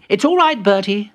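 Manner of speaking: high, loud interjection; high-voiced reassurance. Peter settling the quarrel, high reassuring voice. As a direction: cut in with a high voice.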